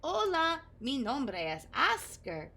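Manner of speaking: "Hola" lifted high, then slow "mi nombre es Oscar"; Spanish introduction. Acted. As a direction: caterpillar introducing himself in careful Spanish. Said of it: "He learned to introduce himself".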